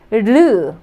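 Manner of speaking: 르 as a light tap, tongue flicking the palate, neither R nor L; Korean 르. Shown as a demonstration. Teacher correcting students' R and L, tapped 르. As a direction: tap 르 once against the palate. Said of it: "it is not like R or L sound".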